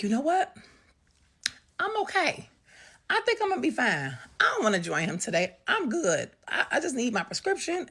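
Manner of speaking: high, loud insistence on being fine; hasty reassurance. Retold or imitated. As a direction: talk your way out, high and emphatic. Hastily talking her way out.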